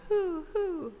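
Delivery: high hooting owl call; imitated owl, high and hollow; owl hoots. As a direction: hoot like an owl in the night. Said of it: "and it was going".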